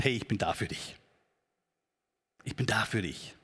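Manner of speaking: tender comfort. Retold or imitated. Friend comforts someone in trouble.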